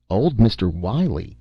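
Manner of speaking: ordinary delivery, nothing marked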